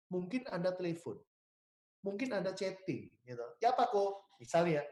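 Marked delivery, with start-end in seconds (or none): 3.6-4.3 пример обращения к клиенту. Shown as a demonstration. Example of calling a client.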